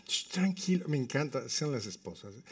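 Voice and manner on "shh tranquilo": soft, placating shush; gentle hushed reassurance; retold hushing. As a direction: imitate a wife quietly settling her husband. Wife hushing husband, retold.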